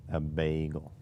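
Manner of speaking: lightly imitating a southern accent to Siri, "A bagel". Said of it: "Siri didn't do good with southern accents".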